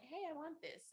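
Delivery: put-on high, bright-eyed child grabbing at something; lightly voiced excited child; eager kid voice. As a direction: ask like a kid who just saw it. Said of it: "I was like".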